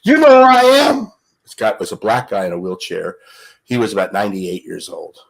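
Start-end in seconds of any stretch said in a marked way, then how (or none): 0.0-1.1 loud, high-pitched champ's challenge; Ali. Retold or imitated. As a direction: call it out big, demanding recognition. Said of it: "And he walks in".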